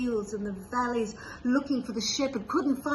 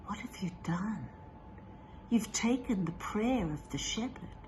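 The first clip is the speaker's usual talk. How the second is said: dream voice whisper; 5 dB quieter than usual. A narrator's reading. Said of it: "the voice said".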